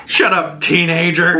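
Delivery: high, punchy telling-off; acted-out irritated order to be silent; mock scolding. Retold snapping at the teen.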